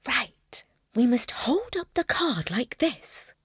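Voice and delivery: hushed, nervous whisper. Acted. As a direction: breathe the instruction secretly. Judith nervously instructing at machine.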